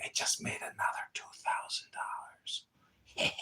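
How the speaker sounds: breathy whispered gloat; mock whisper. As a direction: whisper it like a smug secret. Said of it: "And she's probably like".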